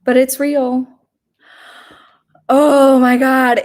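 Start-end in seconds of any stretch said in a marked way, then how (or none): 2.5-3.6 slow, louder oh my God; disbelief. Real, not acted. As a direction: draw it out in disbelief. Reacting to the chipped tooth.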